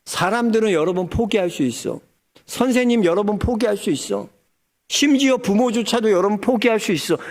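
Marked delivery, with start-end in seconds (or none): none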